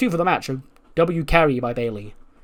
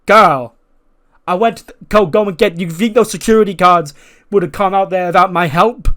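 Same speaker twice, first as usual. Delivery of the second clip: mock angry rant; 5 semitones higher, 8 dB louder than usual. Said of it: "Becky goes".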